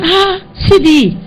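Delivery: high, drawn-out exclamation of recognition; happy recognition. Retold or imitated. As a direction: greet a friend you're glad to see. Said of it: "I was happy to see him".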